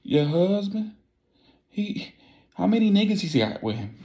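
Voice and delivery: high, shocked disbelief; panicked high voice at the news. Retold or imitated. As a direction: squeak it out in panic.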